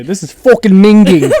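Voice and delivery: loud, high Cockney exclamation through laughter; mock Cockney accent. Friend quoting game slang to co-hosts, loud mock Cockney. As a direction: burst out in hammed-up Cockney. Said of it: "Cockney vernacular".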